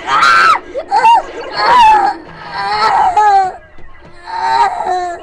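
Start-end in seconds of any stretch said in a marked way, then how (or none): 0.0-3.5 very high piercing screams; shrill screams. Acted. A character screams as disaster strikes, shrill terror.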